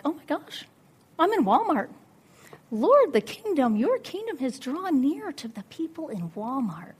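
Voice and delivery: high, loud awestruck exclamation; excited realization. Retold or imitated. Preacher re-enacting her realization in Walmart, excited. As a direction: proclaim it as a sudden revelation.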